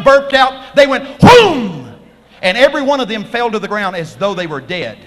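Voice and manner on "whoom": loud whoom falling steeply in pitch; explode, then swoop downward; high explosive whoosh sliding down; imitated blast. Imitates soldiers being knocked down.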